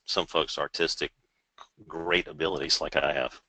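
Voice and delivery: soft, low-pitched deadpan sarcasm; sarcastic. Real, not acted. Presenter jokes about his own artistic abilities, sarcastic. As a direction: drop it low and quiet, mock-boasting. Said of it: "I'm being sarcastic".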